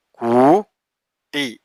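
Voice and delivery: കൂട്ടിൽ stretched very slowly; drawn out. Shown as a demonstration. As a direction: linger on each sound of കൂട്ടിൽ. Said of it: "slow syllable demo".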